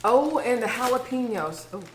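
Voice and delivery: ordinary talk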